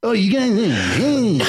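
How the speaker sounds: goofy new singing voice; mock silly voice. He shows off a silly new voice to the band. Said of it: "check this voice out".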